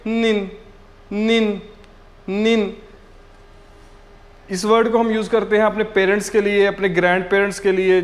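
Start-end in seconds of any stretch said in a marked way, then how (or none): 0.1-2.8 नीन said slowly and clearly, repeated as a model; Chinese pronunciation demo. Shown as a demonstration. Teacher modeling नीन for students, careful pronunciation. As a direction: model the word नीन for learners. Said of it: "नीन कैसे pronounce करेंगे?".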